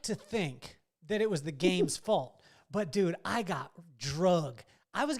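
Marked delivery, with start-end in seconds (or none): none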